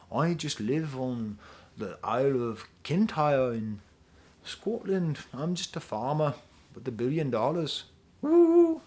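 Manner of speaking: lazily playing the rich farmer, then a sudden woo. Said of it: "[name] being like".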